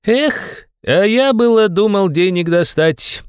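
high, youthful cry of disappointment; young man exclaiming. A narrator's reading. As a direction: exclaim regretfully, voice pitched well up. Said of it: "вскричал молодой человек". (высокий возглас молодого человека)

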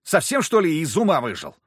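loud indignant outburst; indignant yell. Acted. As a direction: shout it in outrage. Indignant woman yelling.

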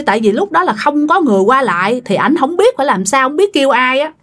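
normal talking voice

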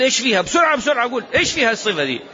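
high, urgent mock impatience; impatient demand. Man told he has a flaw demanding it, impatient.

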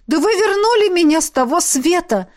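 high-pitched, amazed gratitude; excited exclamation. A narrator's reading. Revived man thanking his master, excited exclamation. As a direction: exclaim it in wonder. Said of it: "воскликнул Эрт".

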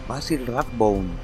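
usual speaking voice

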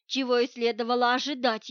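ordinary delivery, nothing marked

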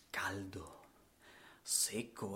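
very soft hushed words; soft intimate sensing; hushed feeling. Acted. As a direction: whisper the heat of the ground. Touching the burning ground.

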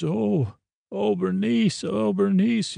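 high-pitched pretend dismay; mock lament. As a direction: coo a hammed-up apology, pitched high. Busker acts upset over rubber chicken.

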